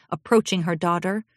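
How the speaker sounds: plain reading voice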